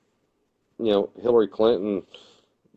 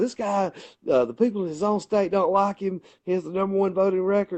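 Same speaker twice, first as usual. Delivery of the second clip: raised-pitch jab at a rival, imitated; mock debater; 8 semitones higher than usual.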